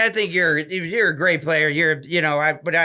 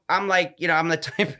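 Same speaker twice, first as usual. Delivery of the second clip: normal talking voice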